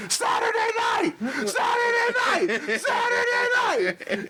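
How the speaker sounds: very high mock yell; hammed-up shrieking wrestler impression; Pitbull yelling. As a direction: screech it repeatedly, dragging it out. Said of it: "he starts yelling".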